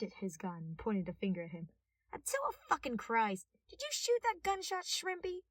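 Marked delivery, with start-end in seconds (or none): 2.1-5.4 high, loud scolding; furious officer demanding answers; angry policewoman. A narrator's reading. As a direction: yell at the little detective. Police woman yelling at detective.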